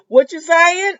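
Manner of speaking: loud, high mock demand; nosy eavesdropper. Jan straining to hear Marlena's secret, loud and nosy. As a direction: burst in loudly, imitating a nosy listener. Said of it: "but Jan is like this. She like me".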